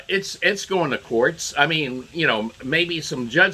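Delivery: ordinary talk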